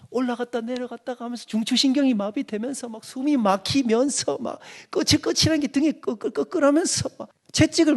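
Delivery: a hint of a pastor milking gory detail; imitating a graphic preacher. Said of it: "목사님들이 ... 디테일하게 설명해".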